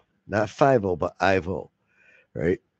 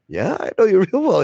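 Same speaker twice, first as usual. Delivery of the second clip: higher, louder knowing reply; lightly voiced cop; 10 semitones higher, 5 dB louder than usual. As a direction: tease him that you know him.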